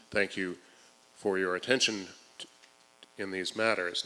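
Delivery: measured, projected public-hearing delivery; formal statement read. Acted. Advocate reads the prepared statement to the forum, formal and projected.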